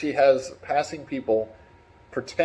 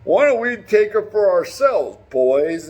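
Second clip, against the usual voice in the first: bandit leader; 4 semitones higher, 4 dB louder, slower than usual. Retold or imitated. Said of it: "They're like".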